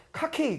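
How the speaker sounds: "커키" with k in both syllables; imitated wrong pronunciation. Teacher quoting the foreigner's mistake back, mispronounced "커키". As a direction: mimic the learner's hard-k "커키". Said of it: "그게 뭐예요".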